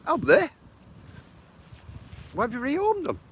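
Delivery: high-pitched, taken-aback questions; surprised questioning. Real, not acted. Streamer reacts to news of rehomed pets, surprised. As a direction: ask in surprise, voice jumping up high.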